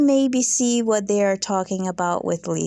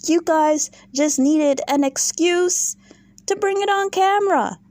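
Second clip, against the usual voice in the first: exasperated rant; 7 semitones higher than usual. Real, not acted. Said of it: "addressing Meredith".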